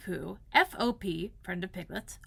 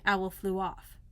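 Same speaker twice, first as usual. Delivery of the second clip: ordinary delivery, nothing marked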